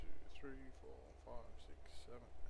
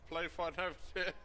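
scolding call-out; 6 semitones higher than usual. Real, not acted. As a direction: raise your voice to break up the play fight. Scolding two players fighting.

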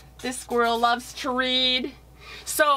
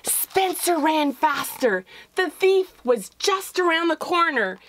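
higher, urgent storytelling; building chase narration; 7 semitones higher than usual. A narrator's reading. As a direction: race toward the reveal.

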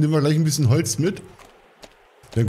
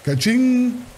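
cash register ka-ching; 11 semitones higher than usual. Acted. Cash register sound on sale.

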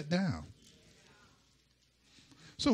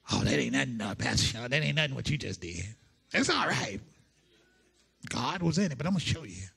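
boastful preacher; 7 semitones lower than usual. Retold or imitated. Mocking a boastful rival preacher.

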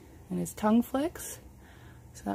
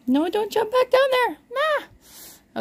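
very high, raised voice to a pet; scolding a pet; 6 dB louder, slower than usual. Real, not acted. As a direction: call out high to stop the animal. Stops pet jumping down.

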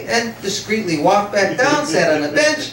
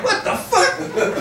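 mock startled yell; 11 semitones higher, much slower than usual. Crowd startled by a firecracker.

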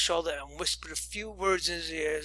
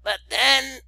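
"But then" called out high; raised cry; 7 semitones higher, 6 dB louder than usual. Acted. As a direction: cry out to the table. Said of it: "cried the old lady at the top of her voice".

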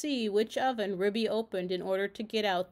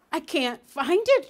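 raised, louder anxious cry; 6 semitones higher, 9 dB louder than usual. A narrator's reading. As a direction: voice a worried character who can't find something. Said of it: "she said anxiously".

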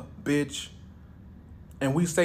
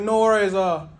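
higher, louder, slow Jamaican patois; mock Jamaican reassurance; 5 semitones higher, 3 dB louder than usual. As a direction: explain it easy in Jamaican patois. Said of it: "He's like".

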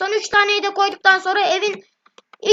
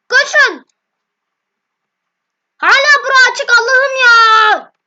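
being chased; 5 semitones higher, 8 dB louder, slower than usual. Real, not acted.

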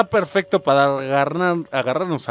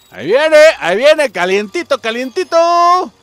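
getting more thrilled as the thing comes near; rising excited squeal; 11 semitones higher, 7 dB louder than usual. Real, not acted. Gamer to his viewers as the ghost closes in, shrieking with excitement. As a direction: pitch climbs high and loud as it gets closer.

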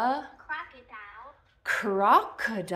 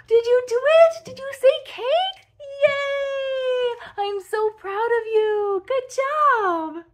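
much higher, louder delighted praise; thrilled praise; 13 semitones higher, 8 dB louder than usual. Real, not acted. Child said cake, praising.

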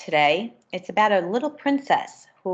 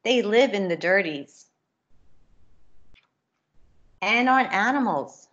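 lively storybook reading with character voices; reading to children; 3 semitones higher than usual. A narrator's reading. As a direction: read it brightly to a class of kids. Reads picture book to children.

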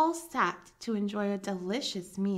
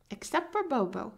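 ordinary delivery

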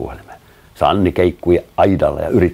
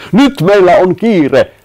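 high, loud, drawn-out call; urgent shout; 14 semitones higher, 9 dB louder, slower than usual. A narrator's reading. Matti rallies the group to hurry, urgent shout. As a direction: shout to get everyone moving, stretching the words. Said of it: "Matti huusi".

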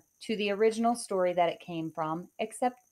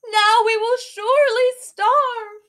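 high loud anguished cry; despairing wail; 13 semitones higher, 11 dB louder than usual. Acted. Mother tossing the beans away, despairing wail. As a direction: lament the coming hunger. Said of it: "she cried".

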